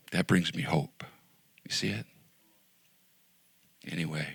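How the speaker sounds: usual speaking voice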